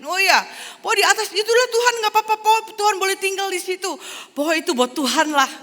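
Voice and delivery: very high, loud, thrilled squeal of welcome; John's delighted welcome. Acted. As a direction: gush over the guest at the door like an overjoyed host. John insists room is God's.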